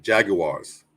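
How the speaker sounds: British accent, JAG-yoo-ars; British pronunciation. Shown as a demonstration. Co-host shows how the British say it, British accent. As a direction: say Jaguars the British way. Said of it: "the British say it".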